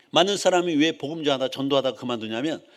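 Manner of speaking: normal talking voice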